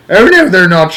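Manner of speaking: high, loud shrill snap; shrill grandmother squabbling; shrill retort. A narrator's reading. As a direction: shriek it back indignantly. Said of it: "shrilled Grandma Josephine".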